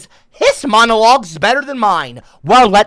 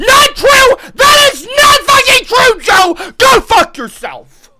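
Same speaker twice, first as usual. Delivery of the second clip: high, loud furious shouting; angry denial; 6 dB louder, slower than usual. Real, not acted. Streamer refuting Joe's video, furious shouting. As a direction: yell it down, then curse them off.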